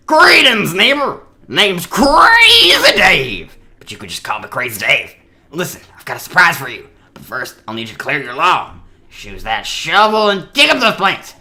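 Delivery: manic character voice lurching into high, drawn-out shouts; throat-straining crazy neighbor, bursting loud and high on key words; Crazy Dave. Acted. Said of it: "hurt my voice so badly using that voice".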